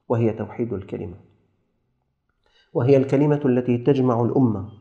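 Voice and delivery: ordinary talk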